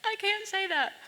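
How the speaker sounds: high, disbelieving protest; retelling her own shocked reply, pitched high; incredulous protest. Retold or imitated. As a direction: squeak out the objection in mock disbelief. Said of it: "And I said".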